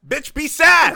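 high, loud mock shout; mock yell. Reporter mock-yelling at the unfazed mother. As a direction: yell at her to be sad, hammed-up. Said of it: "reporter yelling at mother".

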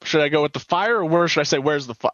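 mock a cop who thinks he's funny; self-satisfied cop. Mimics cops pleased with their jokes.

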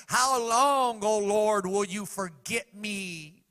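loud, high, slow lament; King David crying out in anguish, loud. Retold or imitated. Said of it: "he cried out in the midst of his anguish".

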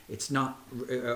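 normal talking voice

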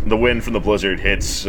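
usual speaking voice

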